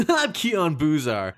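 slow, hammed-up character voice; first voice. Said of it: "I'm gonna do both my voices".

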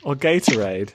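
mock British "water", with no r at the end and a rising tune; imitating a British accent. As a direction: copy her British "water" to tease her. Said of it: "imitate the way I say water".

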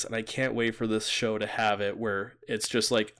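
normal talking voice

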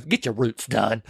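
slow, low, softened jab; deadpan comeback. Retold or imitated. Retelling the comeback on the bench, slow low jab.

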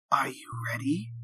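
unvoiced whisper into his ear. A narrator's reading. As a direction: whisper it close, as a question. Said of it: "whispers into his ear".

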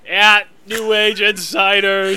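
slow, over-careful reading out; slow mock emphasis. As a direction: spell it out slowly, teasing.

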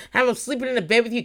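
ordinary talk, nothing marked

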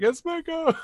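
high delighted squeal of recognition; excited recognition. Retold or imitated. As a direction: gasp it out, thrilled to spot him. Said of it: "I was just like".

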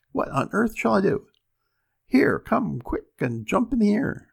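high, loud flustered cry; panicked woman. Acted. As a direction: fluster in sudden alarm. Wife panics.

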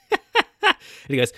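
breaking up with laughter mid-sentence; can't get the words out for laughing. Real, not acted. Host retelling the goof-off call, laughing hard.

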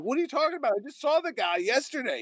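high-pitched incredulous protest; retold disbelief. Reacting to news of an acquaintance's sudden death, incredulous. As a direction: re-enact being stunned, pitch jumping up.

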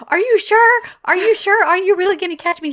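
mock-nervous questioning, high and loud; anxious checking. Woman checking she'll be caught, acted anxiously. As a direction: fret in a high, hurried voice.

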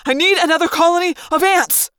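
loud, high, insistent demand; desperate yell. A narrator's reading. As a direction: yell without caring who hears. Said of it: "I yelled, not even caring".

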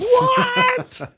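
high, loud shriek of disbelief; shocked shout. Real, not acted. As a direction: scream it in shock. Shocked reaction.

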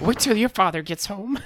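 high, slow mock-mom voice; threatening mom. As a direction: warn like a scolding mother. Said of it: "His, his mom walking in".

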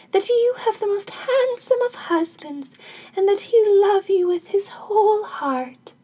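high-pitched fairy voice; second fairy's blessing. Acted. Second fairy grants a husband, high blessing voice. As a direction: pitch it high as a fairy making a grand wish.

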